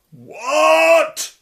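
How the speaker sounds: huge high outraged bellow; giant's outraged bellow. Acted. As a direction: roar it in disbelief. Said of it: "bellowed Denbras".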